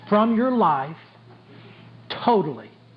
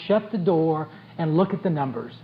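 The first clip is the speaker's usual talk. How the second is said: normal talking voice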